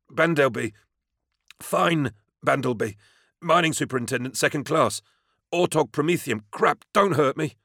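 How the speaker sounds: high, panicked self-introduction; frightened. A narrator's reading. Mining superintendent identifies himself to an armed stranger, frightened. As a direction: blurt out name and rank in fear. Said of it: "pale with fear".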